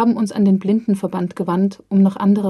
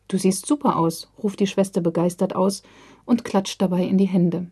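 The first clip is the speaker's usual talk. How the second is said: plain reading voice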